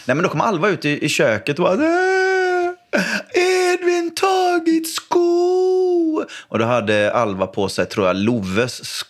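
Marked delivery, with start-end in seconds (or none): 1.7-6.2 put-on high, slow, wailing little-girl voice; whiny little girl. Daughter complaining to dad about her brother, high wailing child voice. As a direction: whine like a toddler robbed of her shoe.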